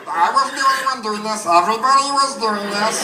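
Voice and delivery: loud, high helium-accident squeak; indignant patient stuck on helium; squeezed high pitch, pleading your case loudly. Acted. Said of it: "with the helium voice".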